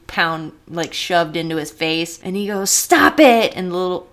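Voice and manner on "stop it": loud, high mock shout; hammed-up yell at a child; imitated scolding. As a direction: mimic a fed-up teacher's sharp command. Acts Arnold scolding the kid.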